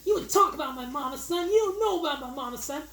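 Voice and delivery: high, fast and riled up about his mama; stoned out freak. Retold or imitated. Wayans' character squaring up to the bird, high fast stoned-freak voice. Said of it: "committed he is to being this stoned out freak".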